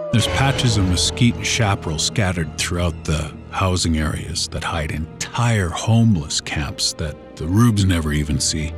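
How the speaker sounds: plain reading voice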